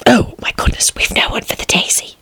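hushed, unvoiced whisper; whispered aside. Readers hastily casting the daisy, whispered. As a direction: whisper it behind the scenes.

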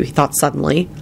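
plain reading voice

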